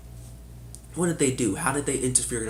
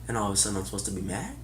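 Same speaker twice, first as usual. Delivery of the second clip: ordinary talk, nothing marked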